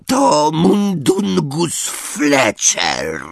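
high, slow croak of a house-elf. A narrator's reading. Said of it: "zakrakał skrzat".

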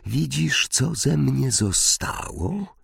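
soft, slow ghostly voice; ghostly question. A narrator's reading. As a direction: ask it like a fading spirit. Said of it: "zapytała twarz". (cichy, widmowy głos)

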